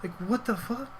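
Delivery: hushed, high-pitched disbelief; a silent freak-out, squeaked under the breath; stunned inner voice. Retold or imitated. Panicked thought about the friend's warrant, hushed and incredulous. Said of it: "Like, in my head".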